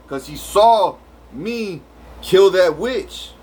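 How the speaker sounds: ordinary delivery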